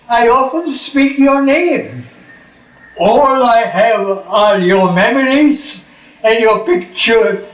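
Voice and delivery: tender, measured poem reading; poem recital. Acted.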